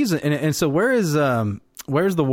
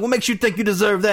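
snooty raised voice; pitched up, sneering guard; high mocking guard; 10 semitones higher than usual. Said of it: "It's like".

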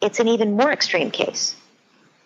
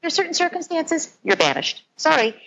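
mock stern parent; 9 semitones higher than usual. Parent voiced banishing child.

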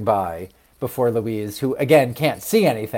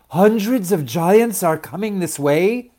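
higher, urgent announcement; alarmed, pitched-up mother tree; alarmed Mama; 6 semitones higher than usual. A narrator's reading. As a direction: raise the alarm in an argument. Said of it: "the argument between Mama and the ambassadors".

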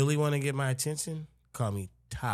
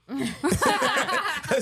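laughing; 5 dB louder than usual. Real, not acted. Laughing while repeating question.

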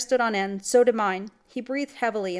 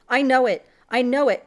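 anguished, raised and loud; cry of agony; 5 semitones higher, 8 dB louder than usual. A narrator's reading. Father cries out in agony over his dead daughter. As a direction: cry it out in grief. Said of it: "cried my father in agony".

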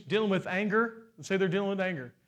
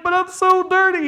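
much louder, higher pleading wail; whiny sinner; 11 semitones higher, 11 dB louder than usual. Pretend. Believer protesting to God, loud pleading whine. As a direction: cry it out like an ashamed child. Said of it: "You're like".

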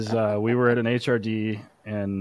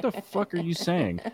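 higher-pitched, incredulous question; mock bafflement; 8 semitones higher than usual. Host retelling his struggle to understand a British decoy, mock bafflement. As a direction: ask it in comic confusion.

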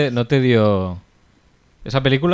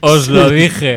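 drawn out, louder I-told-you-so; slow smug told-you-so; 3 semitones higher, 6 dB louder, much slower than usual. Pretend. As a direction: stretch it out slowly, gloating. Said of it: "acabará con Mac Rebo diciendo".

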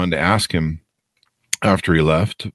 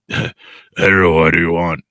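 devil voice. Pretend. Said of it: "that's my devil voice".

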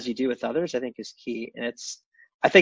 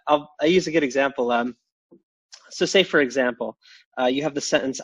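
ordinary delivery

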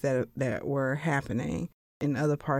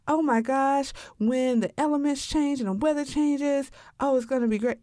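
higher, starry-eyed gushing about the house; dreamy house fantasy; 8 semitones higher than usual. Retold or imitated. Homebuyer imagining life in a new house, higher dreamy gush. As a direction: swoon over the back porch. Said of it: "another one is like".

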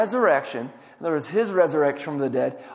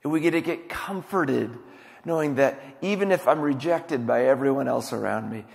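ordinary talk

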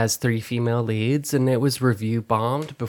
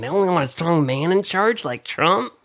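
mocking sarcastic voice; 6 semitones higher than usual. Mocking Trump fans' view.

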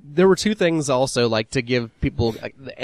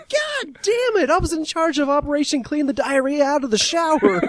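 whining scolded guy. Retold or imitated. Retelling the movie's puke-cleaning complaint, high whiny voice. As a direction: whine it like a pissed-off kid. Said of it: "And he's like".

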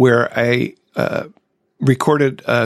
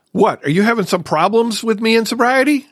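challenging, much higher-pitched question; accusatory; 10 semitones higher than usual. Retold or imitated. Wife confronting husband over his sobriety booklet, accusatory. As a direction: climb in pitch as if putting them on the spot. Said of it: "that sort of accusatory".

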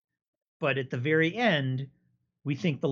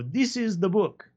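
a hammed-up bad Italian accent, pitched higher; 6 semitones higher than usual. Giuseppe bluffing the lady in a bad Italian accent. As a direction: announce it confidently in a mock Italian accent. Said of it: "I did a bad Italian accent for this character".

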